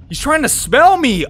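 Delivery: ordinary delivery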